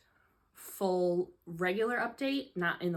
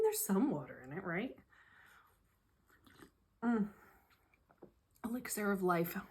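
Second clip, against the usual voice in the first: ordinary delivery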